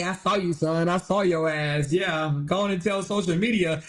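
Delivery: low, deep mock voice of God answering; mock God replying to a crying man; the Lord's voice. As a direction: drop down low and play God, unimpressed. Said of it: "the Lord's supposed to say".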